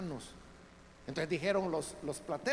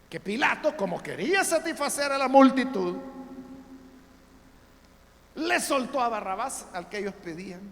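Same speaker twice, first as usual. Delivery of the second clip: high, loud fervent preaching; preaching at full force; 5 semitones higher, 9 dB louder than usual. Acted. Preacher telling the congregation of Pilate, loud and fervent. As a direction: proclaim the verdict with fire.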